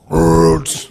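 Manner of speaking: loud, guttural imitated fart; guttural fart noise. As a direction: blurt out a hearty guttural fart sound. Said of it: "guttural fucking, like".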